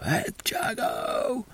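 high, strained mock yell held back in volume; Striker's rising shout. Imitating the commentator calling out to the skater, voice going up. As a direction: squeeze out a drawn-out, high imitation of an excited holler. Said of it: "Striker's voice goes up".

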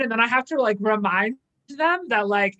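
ordinary delivery

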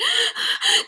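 high, hammed-up dramatic gasp; mock gasp. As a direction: gasp like a bad soap actress. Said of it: "she's like".

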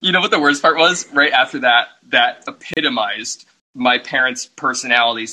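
ordinary delivery

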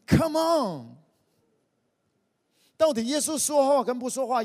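loud, high "Come on!" to the crowd; preacher's loud, high rallying call; rallying shout. Acted. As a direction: fire the congregation up. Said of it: "preacher rallying the crowd".

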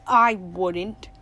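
understated grim refusal. A narrator's reading. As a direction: say it grimly, pitched slightly down. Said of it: "Tuck grimly".

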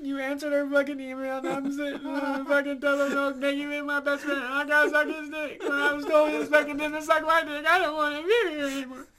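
high, loud, laughing near tears; frantic rant. Acted. Ranting to her friend in mock despair, high and loud. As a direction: wail it in comic panic.

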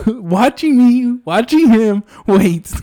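high, slow, laughing disbelief. Real, not acted.

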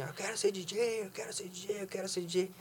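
echo. Retold or imitated. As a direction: imitate the mountain echo dying off. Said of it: "ecoou nas montanhas".

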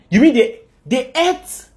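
high, loud shocked question; aghast disbelief. Acted. God hears from Michael that they ate, aghast disbelief. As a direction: rise into stunned incredulity.

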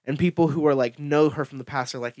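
ordinary delivery

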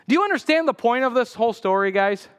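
ordinary talk, nothing marked

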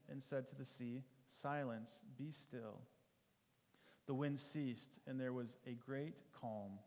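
measured, earnest Bible reading; scripture reading. Acted. Preacher reading Mark four to the congregation, earnest scripture reading. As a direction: read the storm passage to the congregation.

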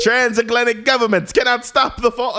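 newsreel announcer. Acted. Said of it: "This is the voice that's like".

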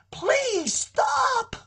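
high, far-off cry of please stop; a desperate father begging his children; God pleading. Acted. Preacher voicing God begging Israel, pleading cry. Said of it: "See, God is pleading with them".

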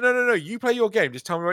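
high, fast, insistent; imitating an eager friend. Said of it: "And she's like".

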